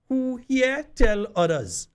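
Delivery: high, slow Grenadian Patois call. Shown as a demonstration. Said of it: "I want to say it in, in Patois".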